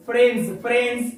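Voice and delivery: "फ्रेंड्स" with a clear final z buzz, repeated; pronunciation demo. Shown as a demonstration. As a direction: model "फ्रेंड्स" ending in z for students. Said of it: "इसको हम पढ़ेंगे फ्रेंड्स". ("फ्रेंड्स" का आखिरी ज़ साफ़ बोलना)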